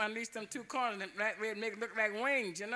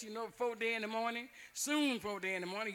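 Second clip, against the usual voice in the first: plain reading voice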